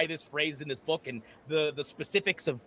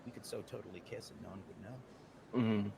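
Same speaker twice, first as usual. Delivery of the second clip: hushed tempting whisper; 11 semitones lower, 12 dB quieter than usual. Acted. GM voices Merle, intimate.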